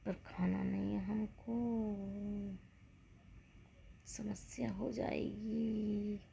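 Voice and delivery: slow whisper. Real, not acted. Resolving not to eat.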